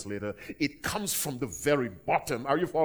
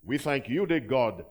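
loud, lifted prayer voice; closing prayer spoken over the people; public prayer; 2 semitones higher, 5 dB louder than usual. Acted. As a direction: pray over the congregation.

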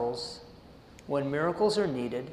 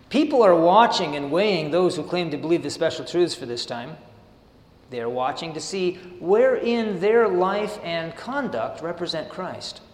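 measured sermon reading of the text; steady devotional reading voice; reading aloud; 5 dB louder than usual. Acted. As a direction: read the passage out to the congregation. Reads the passage aloud.

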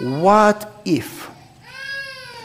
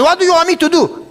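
high, loud giving-in imitation; mock cornered landlord; 13 semitones higher, 7 dB louder than usual. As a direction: give up, pitched high like the cornered man. Landlord gives in.

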